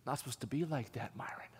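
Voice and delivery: hushed reproof, fading away; whispered rebuke. Retold or imitated. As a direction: whisper it as a private correction. Acting God's quiet rebuke.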